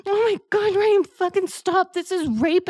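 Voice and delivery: desperate shout. Acted. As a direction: cry out to make him stop. Roleplay, mother begging him stop.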